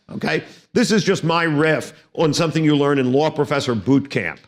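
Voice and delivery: normal talking voice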